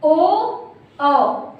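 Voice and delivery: "ओ" as a pure o, then "औ" as an open au; distinct pronunciation. Shown as a demonstration. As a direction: separate "ओ" and "औ" with a long pause. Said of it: "तो ये इस तरह से पढ़े जाएंगे".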